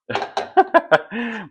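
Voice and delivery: squealing high laugh; high burst of laughter. Real, not acted. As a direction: let out a sudden high laugh. Laughs after her chant.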